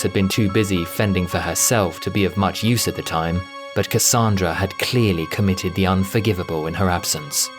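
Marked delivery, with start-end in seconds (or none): none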